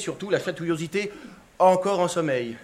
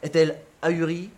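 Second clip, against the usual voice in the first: usual speaking voice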